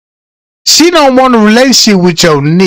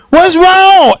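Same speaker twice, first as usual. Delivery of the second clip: put-on girlfriend asking; 9 semitones higher than usual. Girlfriend voiced in retold scene.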